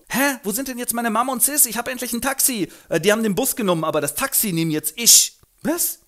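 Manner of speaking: hohe Jungenstimme; Freddy. Acted. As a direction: verwirrt nach Mama suchen. Freddy und Eliza im Taxi. (hohe, verwirrte Stimme)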